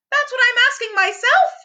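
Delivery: high, quick anxious Piglet voice; a worried little piglet; fretful Piglet. A narrator's reading. Narrator voicing Piglet answering Pooh, high and hurried.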